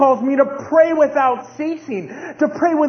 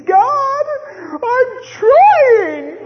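high, loud pleading cry to God; a man on his knees crying out; desperate prayer; 12 semitones higher, 6 dB louder, much slower than usual. Retold or imitated. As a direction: beg God, much higher and louder. Preacher acts his desperate prayer.

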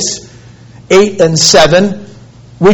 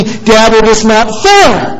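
put-on pleading boy; 4 semitones higher, slower than usual. Eight-year-old son appeals to his dad about bedtime, pleading. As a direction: plead like a wronged child, drawing out the complaint. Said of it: "And he pleads with me".

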